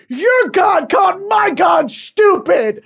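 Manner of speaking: loud, high, slow outraged yell; angry shout. Pretend. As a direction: tattle furiously to your own side. Acted holy war quarrel.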